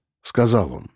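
usual speaking voice

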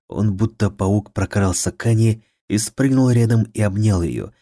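plain reading voice

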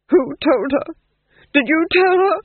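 high loud panicked questions; frantic, near hysteria. A narrator's reading. Panicked Duke.

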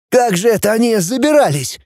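high, effortful wondering; strained. A narrator's reading. Kutnov climbs into the high carriage, straining. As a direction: speak as if straining through a climb. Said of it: "проговорил он натужно".

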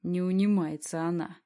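ordinary delivery, nothing marked

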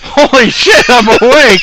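high, loud burst of relief; joyful outburst. Retold or imitated. Recalls waking from endless nightmares, shouting with joy. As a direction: yell it like waking from a fifty-hour nightmare. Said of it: "crying out of pure joy".

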